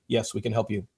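low, restrained stiff professional. Acted. Stiff consultant reassures a prospective client, low and restrained. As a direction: answer with formal, toned-down reserve.

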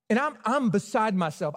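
normal talking voice

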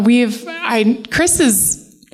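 ordinary talk, nothing marked

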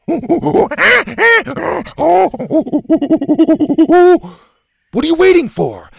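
loud, high call. Acted. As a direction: call across from the door. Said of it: "Gavin called from the door".

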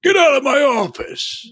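high shouted command; drill sergeant. Retold or imitated. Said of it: "turned back into a drill sergeant".

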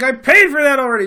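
high, loud mock outrage; hammed-up indignation; indignant American. Tourist insisting to clerk, hammed-up outrage. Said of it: "I'm like".

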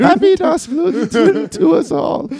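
high, hammy fake German; mock German accent. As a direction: belt it like a silly German toast. Riffing on German title.

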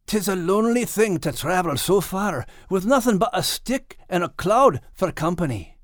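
a hint of a lonely dog at dusk; lonely Max. Max, tired and alone.

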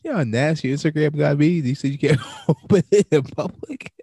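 breathless from laughing; laughing through the words; after laughing hard. Real, not acted.

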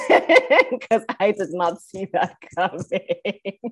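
talking through real laughter; slow, breaking into a laugh mid-sentence. Real, not acted. Podcaster recalling the debate moment that made her lose it, laughing.

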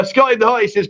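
ordinary talk

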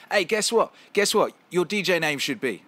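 loud, high-pitched rowdy British lads; excited British guys. Retold or imitated. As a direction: shout it out like a British clubber. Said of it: "all British".

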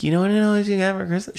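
put-on high, fast taunting kid; bratty kid voice. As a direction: tease like a smug seven-year-old. Kid taunting about Christmas gifts.

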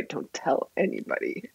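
hushed, conspiratorial mock whisper; secretive whisper. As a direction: drop to a whisper, imitating a secret. Retold secret offer to a friend.

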